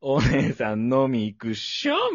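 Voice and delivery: slow, drawn-out cool-guy pickup line; Yosuke Kubozuka. Retold or imitated. Junior trying a pickup on a woman, imitated cool-guy drawl. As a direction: drag it out like a cocky yankee hitting on someone. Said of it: "窪塚洋介的な感じで".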